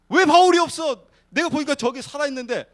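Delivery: loud, high-pitched indignant demand; hammed-up outraged disbelief; angry Lucifer. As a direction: act out a demon boss berating his underlings. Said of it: "루시퍼가 막 화가 나가지고".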